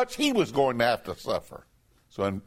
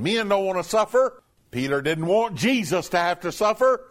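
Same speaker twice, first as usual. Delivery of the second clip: usual speaking voice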